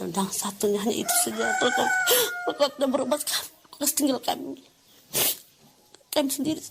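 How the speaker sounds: high-pitched, choked-up retelling; tearful. Real, not acted. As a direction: voice climbing as the tears come. Tearful retelling of child's plea.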